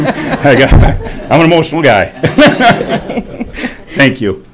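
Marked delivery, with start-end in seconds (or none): 0.1-2.1 higher, louder, laughing through the words; embarrassed laughing. Real, not acted. Laughs off getting choked up. 2.1-3.9 high, breaking laugh after choking up; emotional laugh. Real, not acted.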